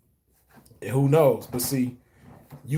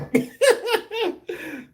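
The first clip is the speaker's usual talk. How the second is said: high laugh. Real, not acted. Laughing at grandma story.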